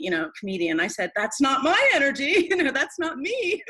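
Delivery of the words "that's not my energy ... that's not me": jokey denial, higher and louder, breaking into a laugh; joking protest. Retold or imitated. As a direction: play it as a comedian insisting it isn't you. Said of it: "I'm a little bit of a comedian".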